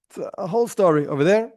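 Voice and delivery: ordinary talk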